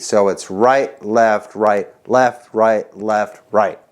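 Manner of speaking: right, left called slowly, each word separate; calling the sticking. Shown as a demonstration. Drum teacher calling out right and left for new players. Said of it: "starting with the right".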